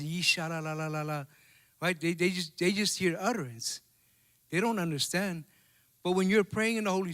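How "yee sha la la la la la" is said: mock tongues babble. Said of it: "All they hear is".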